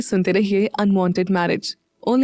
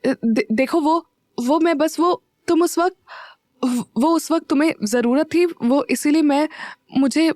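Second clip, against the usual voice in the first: higher, halting, flustered stammer; nervous stammer; 6 semitones higher than usual. A narrator's reading. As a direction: trip over the words, caught out and embarrassed. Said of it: "तो वो घबराते हुए बोली".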